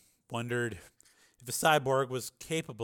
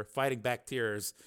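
plain reading voice

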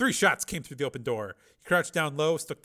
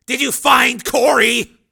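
loud, high barking chief; gruff police chief; 12 semitones higher, 17 dB louder, slower than usual. Acted. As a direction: bark like a surly boss.

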